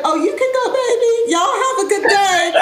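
high, sing-out imitation of an auntie; auntie send-off. Aunties finally dismiss the waiting family, high imitated auntie send-off. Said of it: "one of us said".

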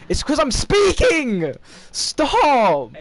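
loud, high, drawn-out complaint; frustrated wail. Real, not acted. Gamer blaming the talking, frustrated high wail. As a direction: wail it loudly in exasperation.

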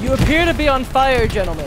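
loud, high mock-polite announcement; loud mock formal. Real, not acted. Mock formal warning in game.

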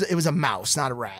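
ordinary talk

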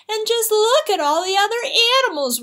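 high, hurried storybook wail. A narrator's reading. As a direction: wail in dismay as a cartoon cat. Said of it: "wailed Cat".

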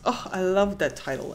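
ordinary talk, nothing marked

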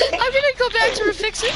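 breathy laugh, high and nervous. Real, not acted. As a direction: laugh off the panic. Nervous laugh after escape.

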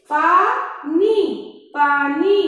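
पानी stretched out slowly, then पानी at normal speed. Shown as a demonstration. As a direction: draw out पानी, then repeat it briskly. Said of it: "इसके हम शब्द भी बता देते हैं".

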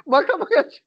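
laughing through the words; angry, helpless laughter; nervous laugh. Real, not acted. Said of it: "sinirden gülüyorum ya".

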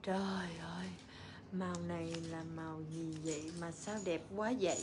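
hushed, amazed whisper; awed whisper. Real, not acted. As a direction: whisper it in awe. Whispered awe at the color.

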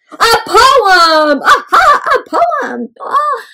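high-pitched burst of inspiration; excited discovery. Acted. Storyteller acting out Cookie's idea for a poem, excited. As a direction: exclaim it like a sudden big idea. Narrator acts out the sudden idea.

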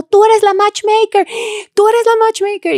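imitating wedding guests. Guests point out the matchmaker at the wedding, high and slow. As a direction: sing it out like a cheering crowd.

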